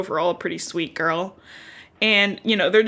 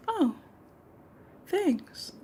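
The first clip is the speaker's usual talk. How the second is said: high, soft, faraway thanks; dazed Annie; 9 semitones higher, 9 dB quieter than usual. Retold or imitated. Annie thanking Deli absently, high and soft. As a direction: murmur it as if half elsewhere.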